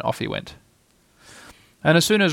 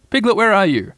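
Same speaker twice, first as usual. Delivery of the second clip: higher, eager questioning; eager call; 7 semitones higher, 4 dB louder than usual. A narrator's reading. Pooh searching for hidden Piglet, eager. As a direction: call out keenly for a friend. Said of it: "cried Pooh eagerly".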